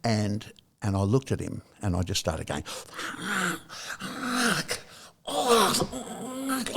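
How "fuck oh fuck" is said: high, stunned gasping swear; shocked swearing. Retold or imitated. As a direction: blurt it out in shock at the sight. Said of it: "like this".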